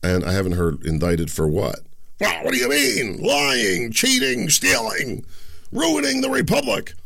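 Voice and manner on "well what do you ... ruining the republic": much higher, sneering mock voice; mock pundit. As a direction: parody the outraged critics, pitched way up. Mocking left-wing pundits.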